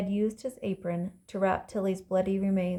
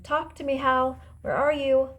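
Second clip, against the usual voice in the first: high, louder searching call; 6 semitones higher, 5 dB louder than usual. A narrator's reading. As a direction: call urgently to someone out of sight. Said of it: "she called again".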